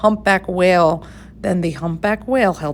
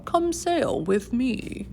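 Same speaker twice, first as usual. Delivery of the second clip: softly and slowly invite the snail aboard; gentle whale invitation; 5 semitones higher, 8 dB quieter, slower than usual. A narrator's reading. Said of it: "said to the snail".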